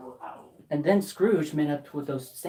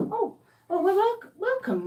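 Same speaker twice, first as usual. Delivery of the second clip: high startled maid; 13 semitones higher than usual. Acted.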